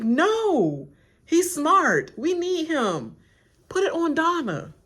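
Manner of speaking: high, loud, slow insisting; loud insistent. Retold or imitated. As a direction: push back hard. Insistent quote.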